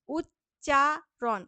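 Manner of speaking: উচ্চারণ drawn out slowly, each syllable apart. Shown as a demonstration. Teacher showing students the syllables of উচ্চারণ, slow and split. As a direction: stretch উচ্চারণ into separate beats. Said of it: "এরকম করে উচ্চারণ করছি".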